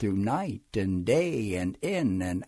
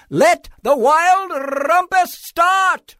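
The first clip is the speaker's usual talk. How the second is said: high, loud, slow drawn-out proclamation; triumphant cry; 7 dB louder, slower than usual. A narrator's reading. Boy king launching the rumpus, long high shout. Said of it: "cried Max".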